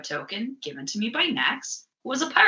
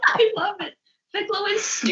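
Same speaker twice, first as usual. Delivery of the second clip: laughing. Real, not acted. Laughing at bird voice.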